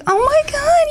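high, gushing squeal of delight; gushing coo. Retold or imitated. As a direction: coo over them like a long-lost friend. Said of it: "I would have cooed".

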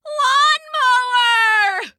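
high, long-drawn warning yell; panicked cricket's warning cry; shouting. A narrator's reading. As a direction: stretch the word out in a loud, high shout. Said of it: "the cricket shouted".

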